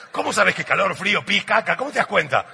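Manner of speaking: loud, high comic interrogation of his wife; hammed-up husband at a loss; exasperated disbelief. Acted exasperated questioning of wife.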